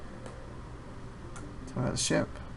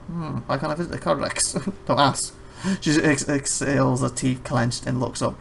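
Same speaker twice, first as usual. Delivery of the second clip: ordinary delivery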